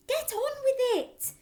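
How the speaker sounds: very high, loud pushy voice; wife prodding husband to act; urgent prod. Acted. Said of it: "hissed the mother".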